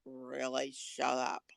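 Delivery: low, quiet put-down; deadpan mock annoyance under the breath; unimpressed. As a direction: drop low and soft, fed up with the drama. Unimpressed reply to the panicker.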